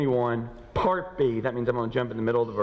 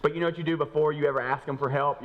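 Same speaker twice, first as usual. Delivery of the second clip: normal talking voice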